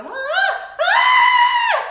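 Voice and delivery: high startled screaming; loud high-pitched scream; retold scream. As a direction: shriek like you've been jolted awake. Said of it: "She heard me go".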